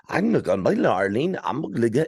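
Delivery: mock garbled Spanish accent; thick Spanish accent. Bathroom attendant talking at mom, mock thick Spanish accent. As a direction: imitate an incomprehensible heavy Spanish accent. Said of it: "he has this really thick Spanish accent".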